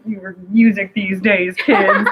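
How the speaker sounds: lightly voiced old-fashioned auntie; slow mock-auntie complaint with a laugh; grumbling auntie impression. Guest mocking herself as auntie about music, lightly put-on scolding. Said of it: "don't wanna sound like auntie".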